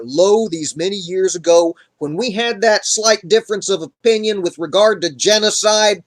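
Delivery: irate dead prophet. Retold or imitated. Samuel reminding Saul of his disobedience, exasperated. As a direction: scold emphatically, hammering each grievance. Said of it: "he's not happy".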